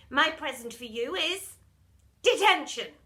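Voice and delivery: spring the punishment with relish; gloating teacher. Acted. Miss Seeth giving pupil detention as gift, gloating.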